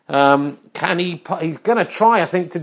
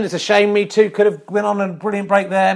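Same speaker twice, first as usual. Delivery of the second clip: ordinary talk, nothing marked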